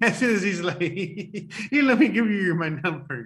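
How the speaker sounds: laughing through the words; cracking up mid-sentence. Real, not acted. Host laughing at the guy's move, real laughter. Laughing while mocking the story.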